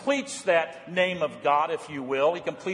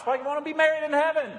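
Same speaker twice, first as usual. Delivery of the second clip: quick, higher challenging question; mock objector; 10 semitones higher, much faster than usual. Pastor voicing a doubting listener, quick and challenging. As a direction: toss the skeptic's question fast.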